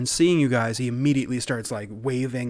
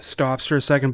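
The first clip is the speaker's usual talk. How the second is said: ordinary delivery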